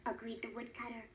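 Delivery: ordinary delivery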